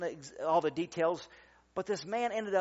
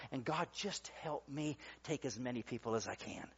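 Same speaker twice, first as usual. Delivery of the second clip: hushed prayer; 6 semitones lower, 10 dB quieter than usual. Acted. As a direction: drop to a low, soft plea. Brief prayer inside the sermon.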